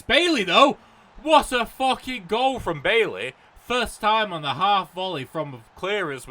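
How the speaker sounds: loud, high, slowed yelling in delight; goal celebration. Real, not acted. Gamer celebrating Bailey's goal, loud joyous yelling. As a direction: roar the goal scorer's name in joy. His team scores a goal.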